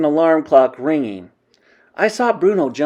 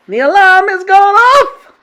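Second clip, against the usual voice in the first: very high, loud, stretched shriek; shrieking witch; 11 dB louder than usual. A narrator's reading. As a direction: screech it in gleeful witch voice. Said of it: "shrieked the Grand High Witch".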